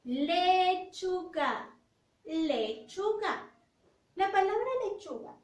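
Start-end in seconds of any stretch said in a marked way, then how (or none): 0.0-1.7 Lechuga stretched out very slowly; slow teacher model of Lechuga; slow model word. Shown as a demonstration. 2.2-3.5 Lechuga stretched out very slowly; slow teacher model of Lechuga; slow model word. Shown as a demonstration.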